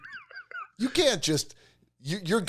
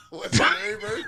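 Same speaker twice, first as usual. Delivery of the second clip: wheezing, high laughing voice; voiceless with laughter; cracking up; 6 dB quieter than usual. Real, not acted. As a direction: squeeze the question out through helpless laughter. Cracking up at the punchline.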